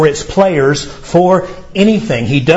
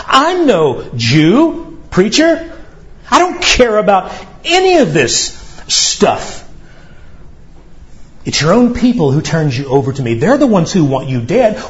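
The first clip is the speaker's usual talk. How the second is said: higher, exasperated scoff; scoffing Pilate; 5 semitones higher than usual. Acted. Preacher voicing Pilate scoffing at Jesus, higher exasperated. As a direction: scoff at the prisoner in disbelief. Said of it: "He instantly scoffs".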